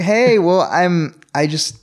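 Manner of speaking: high, halting start; nervous stammer. Retold or imitated. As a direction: stumble in nervously. Starting his apology.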